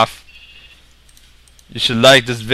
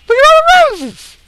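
very high, loud exclamation; excited squeal; 9 dB louder than usual. Pretend. Player spotting lots of roses, high excited squeal. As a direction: squeal it at the top of your range.

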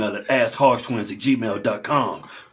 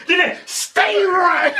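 laughing mock; much slower than usual. Acting out batter, laughing.

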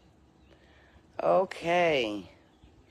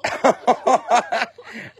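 high burst of laughter; startled laugh; 10 semitones higher, 6 dB louder than usual. Real, not acted. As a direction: laugh out loud in surprise.

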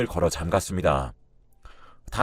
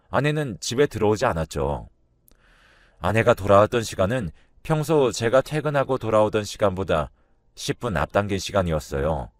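plain reading voice

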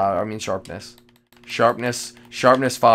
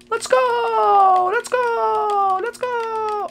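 excited cheer; much slower than usual. Real, not acted. Excited god apple find.

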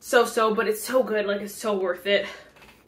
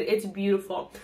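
normal talking voice

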